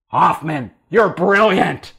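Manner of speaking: booming praise. Retold or imitated. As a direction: boss booming his approval. Boss praises him, shouted slow.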